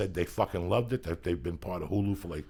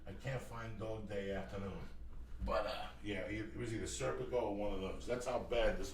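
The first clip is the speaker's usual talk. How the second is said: distant, much softer off-mic talk; away from the microphone; 10 dB quieter than usual. Real, not acted. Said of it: "walking away from the microphone".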